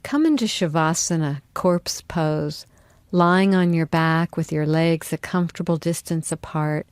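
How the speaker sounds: ordinary talk, nothing marked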